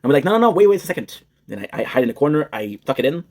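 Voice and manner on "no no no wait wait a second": high, lightly acted urgency; mock urgent halt. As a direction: imitate hurriedly stopping a photo. Acts out halting the photo.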